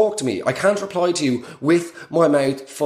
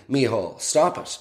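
ordinary talk, nothing marked

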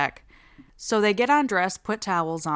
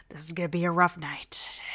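weary resignation; 2 semitones lower, 6 dB quieter than usual. Retold or imitated. Babysitter to himself after police sirens, weary muttered sigh. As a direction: sigh it out under your breath, wearily.

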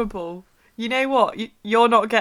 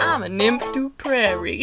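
put-on Southern American accent. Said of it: "read it in a Southern".

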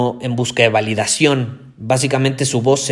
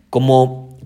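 ordinary talk

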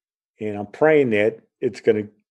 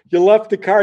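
high, loud incredulous question; loud mock outrage; retold outraged disbelief; 9 semitones higher, 7 dB louder than usual. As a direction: imitate a dad aghast at the news. Father incredulous about abandoned car.

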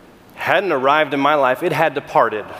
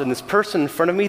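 usual speaking voice

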